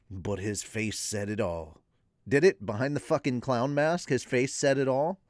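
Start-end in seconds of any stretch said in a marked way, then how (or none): 0.1-1.8 low, soft storytelling; ASMR narration. Acted. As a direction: read it gently, ASMR style. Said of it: "the A S M R voice".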